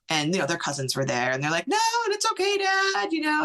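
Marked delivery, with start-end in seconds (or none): 1.7-3.4 put-on high, sing-out kids' voices; kids reassuring dad. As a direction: imitate children calling to their dad, pitched way up. Voicing the kids answering dad.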